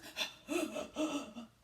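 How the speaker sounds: sharp breathless gasp. Acted. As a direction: gasp for air after blowing. Wolf blowing, out of breath.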